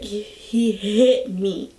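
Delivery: halting, slow mock-crying stammer; barely getting words out. Crying child to mom, imitated choked-up stammer. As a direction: act as if forcing words out through tears. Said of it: "barely trying to get out the words".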